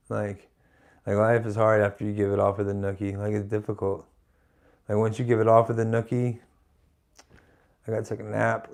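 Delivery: understated spent mumble; mock exhausted. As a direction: sag like life wore you out. Jokingly worn out after nookie.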